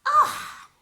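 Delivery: a big high theatrical sigh. Acted. A fairy sighs at Nessa, big high sigh. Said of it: "the fairy sighed".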